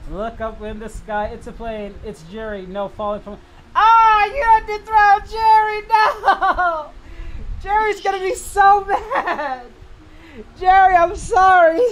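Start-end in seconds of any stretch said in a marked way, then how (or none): 3.7-11.8 high, loud laughing yell; laugh-shout in disbelief. Real, not acted. Streamer laughing loudly at the viewer's prank, high and loud. Laughing shock at Jerry thrown.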